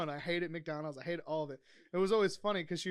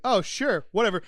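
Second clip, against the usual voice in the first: dismissive customer; 3 semitones higher, 11 dB louder than usual. Retold or imitated. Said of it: "they'd be like".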